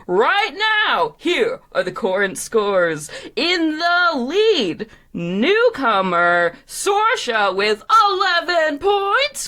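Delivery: high, stretched score reveal; big show announcer. Acted. Host announcing the current scores, grandly. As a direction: boom out the leader slowly, climbing.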